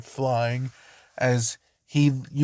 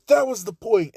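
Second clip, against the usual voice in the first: cold retort; 8 semitones higher than usual. Acted. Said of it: "Todoroki's just like".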